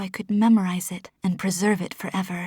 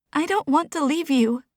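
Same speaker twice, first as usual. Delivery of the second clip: put-on higher, tearful whine like a little child; whining; 6 semitones higher than usual. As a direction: plead like a small child about to cry. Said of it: "made me sound like a whining four-year-old".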